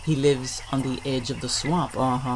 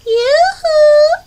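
very high, loud singsong call; teasing call; 11 dB louder, slower than usual. Acted. Possum calls to gator from her tree, teasing. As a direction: trill it out to taunt him from afar. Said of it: "she calls as she swings".

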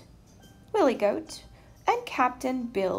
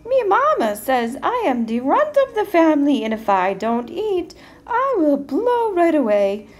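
high, loud little billy goat; 7 semitones higher, 7 dB louder than usual. A narrator's reading. Runt offering up big brother, high little-goat voice. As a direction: bargain high-pitched as the runt. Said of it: "in his little billy goat voice".

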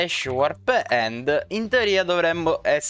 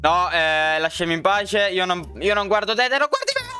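high, desperate pleading shouts; being chased; 8 semitones higher than usual. Real, not acted. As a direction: beg them to leave you alone. Chased by many players.

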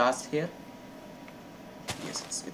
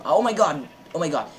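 a real burst of surprise, higher and louder; surprised shout; 6 semitones higher, 6 dB louder than usual. Real, not acted. Gamer spots sugarcane, shouts in real surprise. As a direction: blurt it out at a lucky find.

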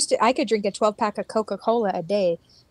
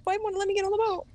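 mock whine; 8 semitones higher, 5 dB quieter, faster than usual. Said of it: "I was like".

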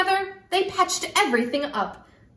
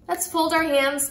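usual speaking voice

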